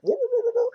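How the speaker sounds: high yelping woo woo of a startled dog; imitated dog barking. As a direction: yelp like a scared junkyard dog backing off. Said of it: "the dog's like".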